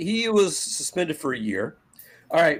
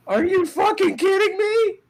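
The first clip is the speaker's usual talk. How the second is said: much higher, loud disbelief; incredulous outburst; 4 dB louder than usual. Real, not acted. Host ranting to his co-host about Cora's report, outraged disbelief.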